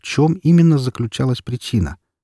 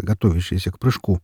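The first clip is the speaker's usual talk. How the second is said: ordinary delivery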